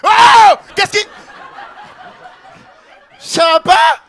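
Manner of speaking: loud startled yell; startled shout. Real, not acted. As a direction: jump and shout in shock. Startled by the goat scream.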